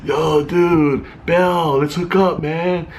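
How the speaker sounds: lightly voiced laid-back hipster dude; a hint of a slacker bro. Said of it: "That's what I was kinda seeing out of that actor".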